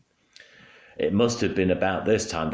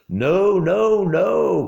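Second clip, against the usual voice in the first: much higher, louder pleading no; calling out; 13 semitones higher, 7 dB louder, much slower than usual. A narrator's reading. Parents begging the armed ducks, panicked shout. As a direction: cry out in panic. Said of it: "called out Mister and Missus Gregg".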